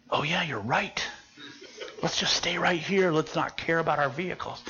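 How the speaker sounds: low, hushed agreement under the breath; hushed retelling. Retold or imitated. Agreeing with Tom to stay hidden, hushed. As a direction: half-whisper it, keeping well down. Acting whispered talk while hiding.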